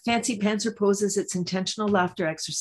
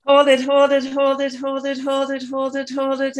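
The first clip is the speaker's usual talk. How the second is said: coaching a held breath, pitch raised; breathing deeply; 4 semitones higher, 3 dB louder than usual. Shown as a demonstration. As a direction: hold it, hold it, urging. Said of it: "Let's breathe in again".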